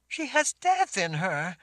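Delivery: frightened. A narrator's reading. Frightened girl explains.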